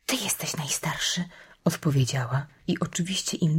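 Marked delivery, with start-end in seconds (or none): 0.1-1.2 hushed whisper back to a brother; whispered reply. A narrator's reading. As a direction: whisper it very softly. Sister answers in whispered exchange. (cichym szeptem)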